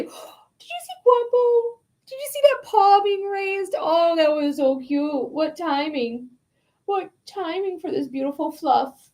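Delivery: high, slow cooing at the cat. Real, not acted. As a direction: coo over the fluffy cat. Cooing over cat on camera.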